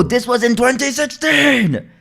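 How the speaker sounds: high, drawn-out exclamation; emphatic correction. Real, not acted. Streamer correcting his own story's date, emphatic high cry. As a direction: stretch it out high in loud protest.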